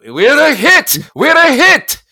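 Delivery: high, loud triumphant shout; excited yelling. Retold or imitated. Producer running in with the reviews, excited yelling. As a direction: yell it like a man bursting in with news. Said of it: "he is yelling".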